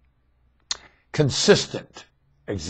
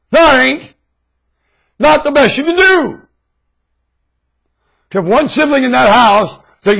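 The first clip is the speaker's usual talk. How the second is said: furious shout; 16 dB louder than usual. Real, not acted. Ranting speaker shouts down teachers' excuse, furious yell. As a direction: roar it back, high and loud.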